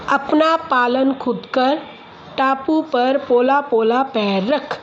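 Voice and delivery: very slow, deliberate articulation; slow practice reading. Shown as a demonstration. Speech teacher reads practice sentences to a child, very slow. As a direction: sound out each word for a child.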